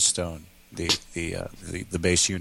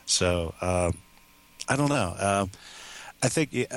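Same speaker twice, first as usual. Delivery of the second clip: ordinary talk, nothing marked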